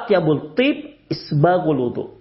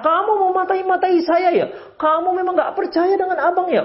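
high-pitched, indignant mock outburst; acted angry comeback, pitched way up; angry husband; 12 semitones higher than usual. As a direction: play a husband turning the accusation on his wife.

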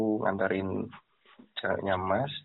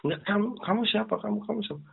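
put-on higher, fast demanding boyfriend; angry boyfriend; 12 semitones higher, faster than usual. Boyfriend demanding the driver explain himself, higher and fast. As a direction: fire the question at him, higher and quick.

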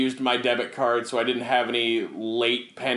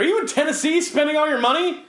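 pitched-up bank agent voice; high questioning caller; 14 semitones higher, 4 dB louder than usual. Retold or imitated. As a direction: ask it up high, puzzled. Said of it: "They're like".

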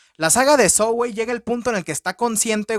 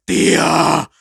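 hammed-up spoiled girl, louder and slow; mocking the girl who begs for her doll back; mock bratty girl; 4 semitones lower, 4 dB louder, slower than usual. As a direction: whine and demand Megan like a spoiled kid. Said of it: "y dice".

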